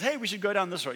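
mock eager invitation; upbeat tempting suggestion. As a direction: sell the bad idea brightly. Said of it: "somebody says".

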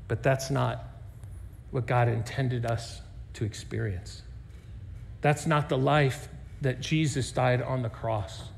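low, soft, solemn preaching; hushed sermon. Acted. Pastor warns the church about distance, in a low solemn hush. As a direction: draw them in close and quiet.

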